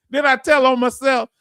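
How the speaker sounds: giggling through a sheepish question; amused, self-mocking laugh. Real, not acted. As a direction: laugh as you catch yourself bragging. Laughing at his own boast.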